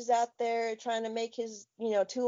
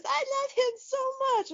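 smitten daughter; faster than usual. Retold or imitated. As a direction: swoon like a starstruck kid. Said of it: "She goes".

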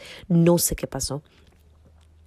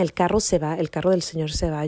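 plain reading voice

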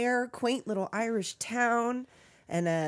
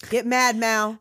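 louder, punchy goading; acted urging; 3 semitones higher, 8 dB louder, slower than usual. Host goads her co-host to get mad, mock-angry. Quotes a line urging anger.

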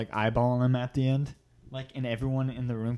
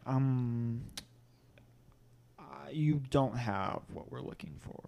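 Lorne Michaels impression; 8 dB quieter, slower than usual. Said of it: "I think that's pretty good".

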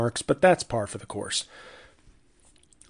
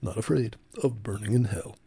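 softer, subdued put-on slogan voice; toned-down Ron Reagan Junior; 5 dB quieter than usual. Host showing the toned-down version of the ad, soft subdued impression. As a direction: deliver the tagline quietly, toned down. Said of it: "that was the toned down one he's doing now".

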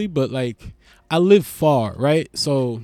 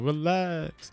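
soft relax; 8 dB quieter than usual. Shown as a demonstration. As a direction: say Relax softly to calm things. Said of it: "What you talking about?".

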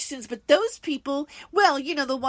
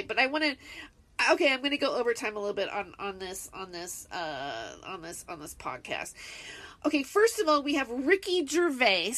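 ordinary delivery

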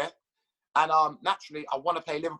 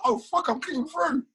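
mock astonishment; 9 semitones higher, slower than usual. Retelling shock on the pitch.

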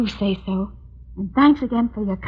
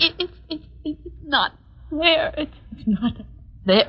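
dying whimper; 5 semitones higher than usual. Acted. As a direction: fade out, stammering in pain. Dying wife speaks.

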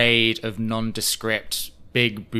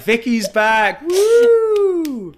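high, loud mock cheer for a comeback; imagined crowd cheering; 10 dB louder, much slower than usual. Co-host imagines the crowd cheering Vicky, hammed-up loud cheer. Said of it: "in her head, everyone's clapping".

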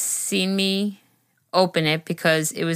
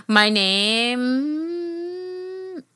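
high, hugely stretched-out words; drawn out; 10 semitones higher than usual. Pretend. Host reading the letter writer's introduction, playfully drawn out. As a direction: hold the word for ages, playfully.

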